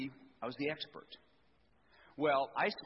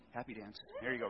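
ordinary delivery

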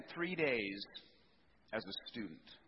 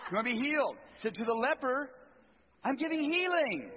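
jubilant proclaiming; 10 semitones higher, 6 dB louder than usual. Acted. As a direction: build to a joyful announcement. Building proclamation of healing.

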